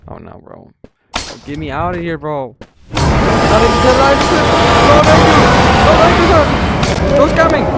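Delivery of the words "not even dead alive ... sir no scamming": high, loud yelling; high shouting. Real, not acted. Yelling at an ogre.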